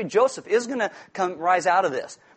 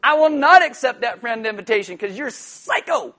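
loud, laughing refusal; mock loud rejection; 5 semitones higher, 8 dB louder than usual. Preacher acting out refusing an ex's friend request, loud laughing rejection. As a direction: shut it down with a grin.

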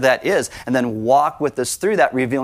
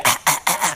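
short, repeated voiceless cries of panic; mock gasping as if going under; panicked gasps; faster than usual. Preacher imitating himself sinking, gasping in mock panic. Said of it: "that's probably what I would do".

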